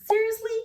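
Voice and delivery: "Seriously?" pitched high, going up as a question; rising intonation. Shown as a demonstration. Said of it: "a rising intonation".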